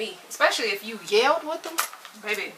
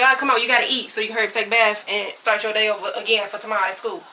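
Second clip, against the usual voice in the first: rushed parent; 5 dB louder, faster than usual. Retold or imitated. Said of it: "when they're like".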